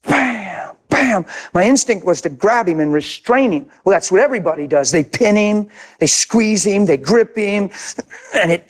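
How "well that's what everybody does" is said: usual speaking voice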